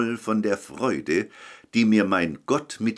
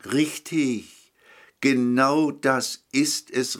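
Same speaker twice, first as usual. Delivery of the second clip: higher-pitched, mildly excited exclamation; calling out; 7 semitones higher, slower than usual. A narrator's reading. Market woman to customer who proved the sun, calling out. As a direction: call it out in emphatic agreement. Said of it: "rief sie".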